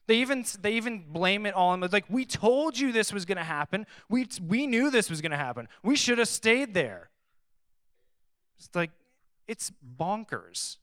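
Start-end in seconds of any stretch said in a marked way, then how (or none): 2.1-7.1 higher, whining know-it-all complaint; mock whining; whiny told-you-so. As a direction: nag like a crowd saying they warned you. Voicing the complaining Israelites.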